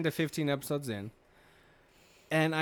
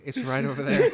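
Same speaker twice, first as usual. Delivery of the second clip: breaking up with laughter, high and loud; can't hold the laugh in; giggling through the answer; 10 semitones higher, 7 dB louder than usual. Real, not acted.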